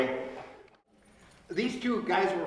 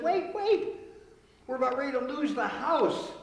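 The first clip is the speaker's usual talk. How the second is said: higher, louder urgent wife; 9 semitones higher, 4 dB louder than usual. Acted. Wife cutting off her husband, higher and louder. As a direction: stop him with rising urgency.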